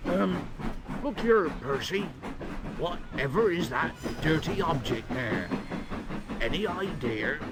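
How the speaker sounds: a lightly voiced sly old tram; mocking tram. A narrator's reading. Toby pretends not to know James, sly mock innocence.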